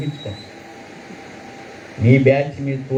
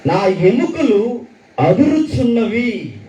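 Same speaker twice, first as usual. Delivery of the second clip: scripture reading; 6 semitones higher, much slower than usual. Acted. Quotes the verse slowly.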